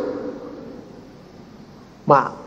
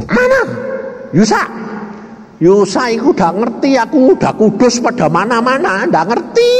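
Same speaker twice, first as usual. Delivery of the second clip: a wife searching anxiously; worried wife; 9 semitones higher than usual. Retold or imitated. Wife voiced, searching for him. (istri cemas bernada tinggi)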